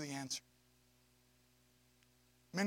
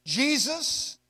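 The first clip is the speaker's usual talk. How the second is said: proclaimed name; 6 semitones higher, 6 dB louder than usual. Shown as a demonstration. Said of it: "Check this out".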